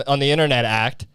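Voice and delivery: normal talking voice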